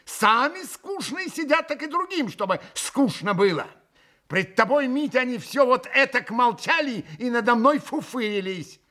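high, vexed complaining; досадливая жалоба. A narrator's reading. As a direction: pour out the grievance to Mitya. Grushenka's vexed complaint goes on. (раздражённо, высоким голосом)

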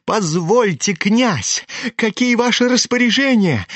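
high-pitched, belligerent demand; spiteful, pushy drunk in a high voice; tipsy, insolent. A narrator's reading. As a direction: pester the prince, pitch climbing high. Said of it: "хмельной и озлобленный до нахальства".

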